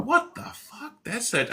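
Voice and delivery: can't believe the name he just read; stunned disbelief. Retold or imitated. Musician discovering a famous drummer messaged him, stunned disbelief. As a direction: pitch leaps way up, slow and incredulous. Shock at [name]'s name.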